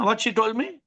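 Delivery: ordinary delivery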